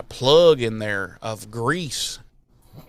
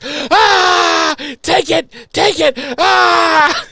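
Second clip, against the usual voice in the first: high, loud hammed-up screams; wild mock yelling with her face on the grill; mock screaming; 7 dB louder, much slower than usual. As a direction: shriek it like a cartoon victim. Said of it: "she's just like".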